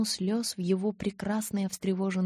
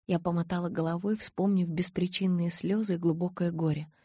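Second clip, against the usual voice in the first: plain reading voice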